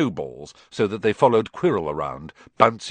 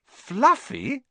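surprised; 14 semitones higher than usual. A narrator's reading. Harry echoes the name.

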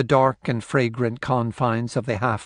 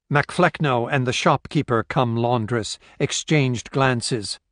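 plain reading voice

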